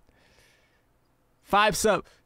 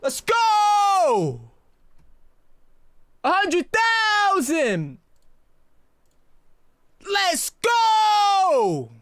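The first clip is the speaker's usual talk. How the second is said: ecstatic screaming; 5 semitones higher, much slower than usual. Real, not acted. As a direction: scream with joy at the milestone. Celebrating the milestone.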